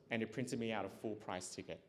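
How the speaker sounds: normal talking voice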